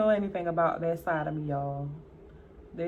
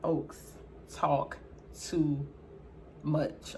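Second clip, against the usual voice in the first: each word spaced out, softer and lower; slow and deliberate; 3 semitones lower, 7 dB quieter, much slower than usual. Real, not acted. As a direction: drag it out word by word. Slow deliberate take, then repeated normally.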